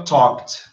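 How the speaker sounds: "talked" in one syllable, tawk-t. Shown as a demonstration. Teacher adds another example, "talked" said tawk-t. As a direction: end "talked" on a T. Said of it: "só pra você saber pronunciar corretamente".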